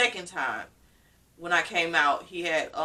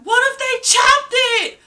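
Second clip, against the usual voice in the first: panicked yelling; 10 dB louder than usual. Retold or imitated. Groggy patient asks her aunt what got cut, panicked yelling. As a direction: re-enact a frantic patient crying out. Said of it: "I was like".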